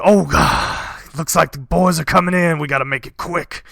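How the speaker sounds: a bandit chief breaking into a house; bandit king. Acted. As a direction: bellow to his men. Bandit king sees attackers.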